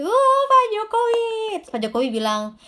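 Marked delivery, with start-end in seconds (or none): 0.0-1.3 high, loud mock-excited fan cry; starstruck greeting. Congregant imagining meeting the president, starstruck and excited. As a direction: gush like a thrilled fan, pitched way up. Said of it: "kalau ketemu Pak Jokowi".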